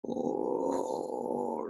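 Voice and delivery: long, very low, soft "oh"; dismayed groan. A narrator's reading. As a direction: let the joy sink into a low groan. Father dismayed by the warning.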